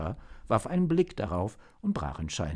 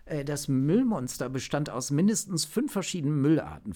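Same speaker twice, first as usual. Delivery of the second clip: put-on higher-pitched, earnest young-agent report; agent explaining himself; 7 semitones higher than usual. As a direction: justify your technical choice to the chief. (ernsthaft erklärender Agent, höhere Stimme)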